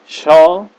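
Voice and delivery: Shall with a clear sh sound, high and loud; say shall clearly. Shown as a demonstration. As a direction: model Shall as sh-al, not s-al. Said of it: "Bacanya shall". (ucapkan Shall dengan bunyi sh yang jelas)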